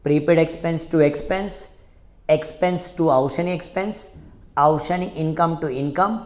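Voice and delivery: slow, evenly chanted entries; slow chanted memory drill; slow recitation drill. Shown as a demonstration. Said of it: "साथ में बोलो".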